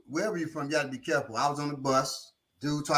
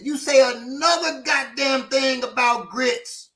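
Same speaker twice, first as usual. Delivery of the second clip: slow, higher mock warning; imitated slow-burning menace; offended threat, retold; 4 semitones higher than usual. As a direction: spell out the threat word by word, like an offended local. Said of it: "he felt disrespected".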